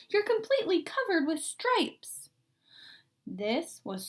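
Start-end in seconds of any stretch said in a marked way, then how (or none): 0.1-2.3 high-pitched shocked mother; mother staring in disbelief; a mother's startled exclaim; alarmed mother. Acted. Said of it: "she cried".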